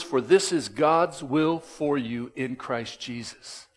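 slow, deliberate quote stressing this; slow emphatic quote. Real, not acted. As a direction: lay each word down slowly.

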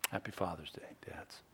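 hushed, unvoiced farewell; gentle whispered Father's Day wish; soft whisper. Real, not acted. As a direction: whisper it warmly to the room. Closing blessing to the fathers.

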